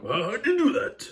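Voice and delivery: very high, squeaky disbelief; astonished. Acted. Gordon amazed at the coaches moving on their own, very high. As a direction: gasp it out in shock.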